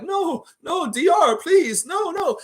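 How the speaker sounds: high, whiny pleading voice; helpless pleading fan. Retold or imitated. A fan helplessly begging DeRozan, high and whiny. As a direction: plead like a dad who can't stop his kid. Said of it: "to sit there and be like".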